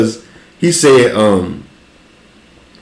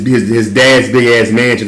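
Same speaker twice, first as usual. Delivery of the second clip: ordinary talk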